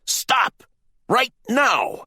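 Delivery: loud, very high shouted command; yelling. A narrator's reading. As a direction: yell to halt someone at once.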